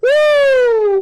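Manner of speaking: very high, loud "Woo"; loud whoop. Real, not acted. Host celebrating a wild comment, loud high whoop. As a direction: holler it out.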